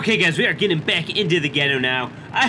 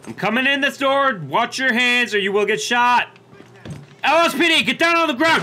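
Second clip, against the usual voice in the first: shouting commands; 8 semitones higher, 4 dB louder than usual. Pretend. Storming apartment, ordering suspect out.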